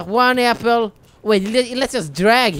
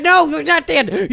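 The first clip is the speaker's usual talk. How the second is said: ordinary talk, nothing marked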